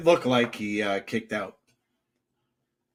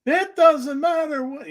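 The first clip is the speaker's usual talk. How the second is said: high loud mock Rock promo; Rock impression; 6 dB louder than usual. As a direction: bark it like The Rock cutting a promo. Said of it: "How he bait people into saying".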